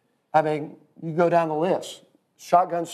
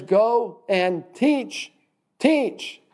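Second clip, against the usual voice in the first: slow, emphatic TEACH, then TEACH higher still; stress on TEACH; 6 semitones higher, much slower than usual. Shown as a demonstration. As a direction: TEACH set apart, high and stretched. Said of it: "he didn't say, "Go preach the gospel".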